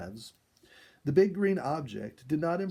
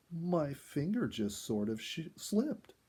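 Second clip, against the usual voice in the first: usual speaking voice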